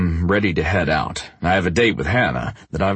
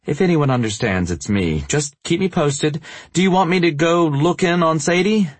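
higher, gentle woman's voice; 12 semitones higher than usual. Acted. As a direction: voice her higher, caring. Narrator voices Hannah, higher.

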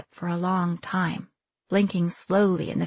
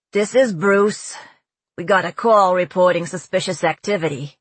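loud Brooklyn radio officer; Brooklyn accent; 6 dB louder than usual. A narrator's reading. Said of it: "The accent was pure Brooklyn".

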